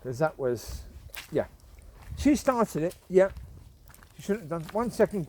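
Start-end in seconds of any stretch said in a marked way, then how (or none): none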